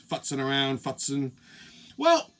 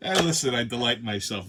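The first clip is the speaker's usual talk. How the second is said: usual speaking voice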